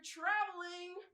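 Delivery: high, drawn-out mock referee call; referee's call. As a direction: call the foul across the gym. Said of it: "that's when I hear".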